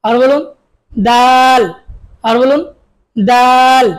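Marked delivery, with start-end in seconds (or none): 0.9-1.6 দাল thin and light, a little louder; a light crisp দাল; light dal. Shown as a demonstration. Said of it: "পাতলা". 3.2-3.9 দাল thin and light, a little louder; a light crisp দাল; light dal. Shown as a demonstration. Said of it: "পাতলা".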